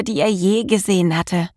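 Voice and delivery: ordinary delivery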